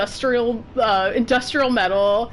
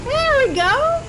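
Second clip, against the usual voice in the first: much higher, loud cheer; triumphant cheer; 14 semitones higher, 3 dB louder, slower than usual. Real, not acted. Playing the game, cheering a success. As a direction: celebrate the win.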